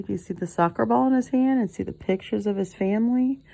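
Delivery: plain reading voice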